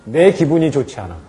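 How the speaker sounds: ordinary talk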